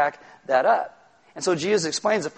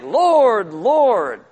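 loud, drawn-out fervent cry; impassioned, high-rising first cry, falling away; emotional engagement; 4 semitones higher, 6 dB louder than usual. Acted. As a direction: call out passionately, stretching each word. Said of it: "they have emotional engagement".

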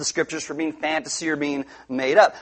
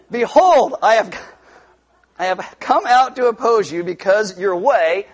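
louder solemn proclamation; commanding angel; 3 semitones higher, 5 dB louder than usual. Acted. Angel confronting Balaam on the path, louder proclamation.

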